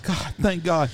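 normal talking voice

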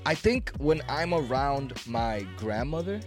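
normal talking voice

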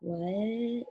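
soft, drawn-out "what"; a quiet, lingering mock "what"; off-the-mark roommate imitation. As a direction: stretch it out quietly, as a put-on quote. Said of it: "She doesn't talk like that".

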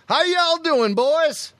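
high-pitched, put-on Southern twang; hammed-up imitation of a Southern drawl; acento sureño fingido. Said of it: "he'd maintain the accent".

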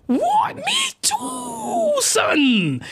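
very high falsetto squeal; over-the-top squeaky astonishment; hammed-up delighted shock. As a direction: squeal it in mock amazement. Character's overjoyed reply.

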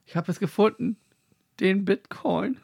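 high, thrilled mock discovery; excited. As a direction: cry out as if striking gold. Joking about finding treasure.

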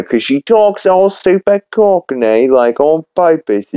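mock cockney. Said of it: "just like my British accent".

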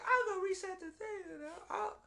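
hammed-up, very high female impression; Ellie. Host plays heroine volunteering, in mock falsetto. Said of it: "Ellie's like".

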